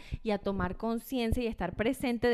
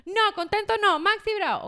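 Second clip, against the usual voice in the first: put-on higher, louder cranky toddler protest; mock toddler tantrum, pitched up and loud; angry toddler; 8 semitones higher, 6 dB louder than usual. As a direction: stamp it out like a cross little boy. Mother voices angry toddler.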